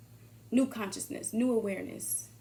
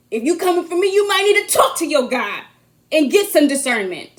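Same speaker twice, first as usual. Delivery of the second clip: preachy shout; 4 semitones higher, 16 dB louder than usual. Real, not acted. Shouting at those coming for her.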